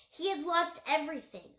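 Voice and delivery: plain reading voice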